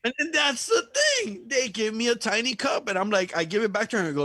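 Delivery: high, worked-up complaint; exasperated ranting. Real, not acted. Host ranting about the tiny cup, exasperated. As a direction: hammer out the grievance, pitched well up.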